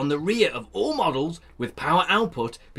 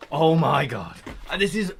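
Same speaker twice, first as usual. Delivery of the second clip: ordinary delivery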